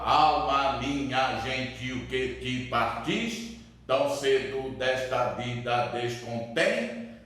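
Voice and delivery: slow, even scanning of the verse; syllable-by-syllable. Shown as a demonstration. Teacher scanning Camões's second example for class, syllable by syllable. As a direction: break the line into measured syllables. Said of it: "eu tenho que contar".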